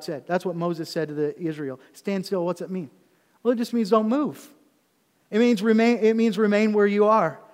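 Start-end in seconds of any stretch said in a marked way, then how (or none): none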